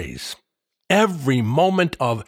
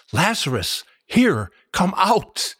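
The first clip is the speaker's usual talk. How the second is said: loud cry; 7 semitones higher than usual. A narrator's reading. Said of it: "He cried in a loud voice".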